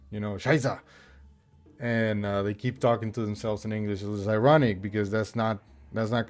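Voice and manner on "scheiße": German curse. Retold or imitated. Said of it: "curse in German".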